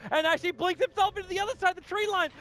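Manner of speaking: commentary building to a high-pitched peak; excited play-by-play. Acted. Caster to viewers as a hero narrowly escapes, excited play-by-play.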